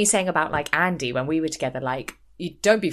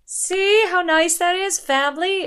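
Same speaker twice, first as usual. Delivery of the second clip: mock sweet hostess; 11 semitones higher, 6 dB louder than usual. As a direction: coo it to the whole family. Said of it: "Jacqueline's like".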